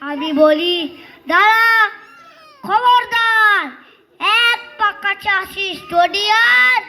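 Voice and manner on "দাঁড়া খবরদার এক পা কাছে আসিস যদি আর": put-on child hero's high-pitched threat, easing slightly; defiant warning. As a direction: halt the bandits with a raised, threatening cry. Child hero challenges the bandits.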